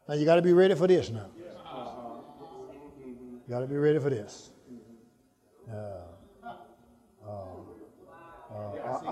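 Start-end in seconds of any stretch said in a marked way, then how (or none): none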